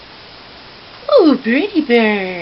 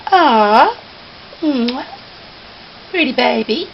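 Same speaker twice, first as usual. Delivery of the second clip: usual speaking voice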